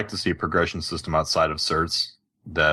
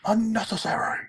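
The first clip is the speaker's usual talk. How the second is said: mock echo. Echoing the post title mockingly.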